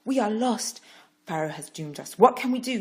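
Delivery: high, loud panic; panicked. Acted. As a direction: cry out in fear. Frightened crowd voice.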